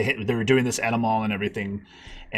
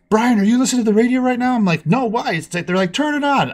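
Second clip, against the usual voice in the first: much higher, louder, fast excited call; excited aunt; 11 semitones higher, 6 dB louder than usual. Retold or imitated. Aunt phoning Brian about the radio, excited. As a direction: rush the news out breathlessly.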